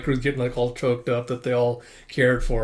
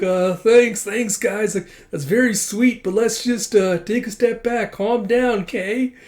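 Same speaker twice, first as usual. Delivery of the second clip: higher-pitched, uneasy acted thanks; awkward placating; 8 semitones higher, 4 dB louder, slower than usual. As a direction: gently fend off overexcited fans. Acted senior calming overexcited pupils.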